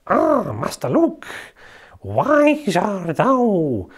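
drawn-out Scottish sage voice, savouring each word; Master Yoda, Scottish. Retold or imitated. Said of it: "There's Master Yoda in a Scottish accent".

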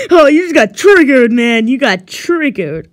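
loud, laughing mockery of a scared brother; laughing taunt. Luigi laughing at Mario for getting scared. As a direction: crow over him through laughter. Teasing Mario after scaring him.

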